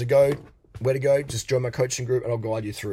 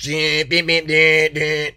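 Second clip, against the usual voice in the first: loud ringing ding ding; ding it out like Bangkok street noise; imitated bells; 2 semitones higher, 8 dB louder, much slower than usual. Recalling noisy Bangkok traffic, loud ding imitation. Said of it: "And Thailand, it's just".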